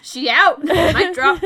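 laughing out the words. Real, not acted. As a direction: laugh while saying it. Laughing joke.

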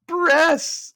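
hammed-up excited squeal; mock excited. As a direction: cry it out gleefully high. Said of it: "Jeff gets excited and says".

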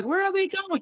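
high-pitched worried dog; anxious dog's voice. Acted. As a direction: squeal it lightly as a fretting dog.

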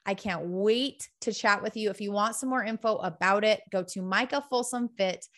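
bright, pitchy sales pitch for her coaching team; upbeat ad read. Acted. Host pausing her show to recruit women, cheerful ad read. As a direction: sell the mentoring program with cheer.

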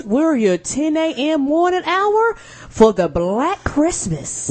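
slow, high radio host voice; radio announcer. Acted.

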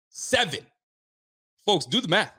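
excited. Real, not acted. Hyped about seven sacks.

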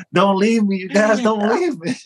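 high laughing mock plea. Real, not acted. Wrestler pleading with his partners, high laughing plea. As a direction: beg through laughter.

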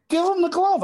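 dad snapping at his stubborn son; retold angry order. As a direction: bark it like a fed-up father. Dad angrily orders kid.

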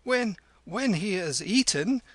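high-pitched, hesitant deference; fawning. A narrator's reading. Hillman seeking favour from Kim, fawning. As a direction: stumble into a servile request. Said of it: "the hillman fawned on Kim".

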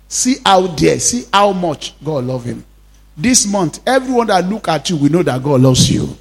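normal talking voice

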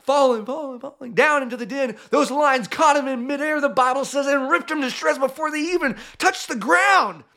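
high-pitched narration building to a peak; animated, rising climax; excited storytelling. A narrator's reading.

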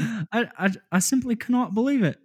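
high, loud, fast hammed-up British accent; failed British accent. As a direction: push a silly posh voice and fail. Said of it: "I, I can't do it, man".

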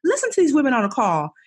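high, sassy pat on her own back; self-praise. Retold or imitated. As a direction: cheer yourself on with some sass. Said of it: "And I was like".

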